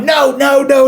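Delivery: high-pitched mock protest; protesting refusal. Guest being dragged up to the dance floor, protesting.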